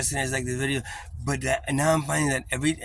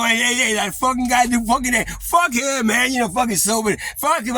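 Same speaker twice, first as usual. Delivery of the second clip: much higher, loud, rowdy tough guys; mock the crude swagger; imitating gang cursing; 12 semitones higher, 7 dB louder than usual. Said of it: "They were fucking, you know".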